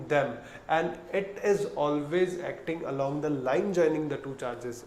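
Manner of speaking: slow, word by word dictation. Shown as a demonstration. Teacher dictating the law slowly to students. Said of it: "मैं आराम से धीरे धीरे बोल रहा हूँ".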